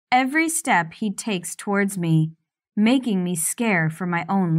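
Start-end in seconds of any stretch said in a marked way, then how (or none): none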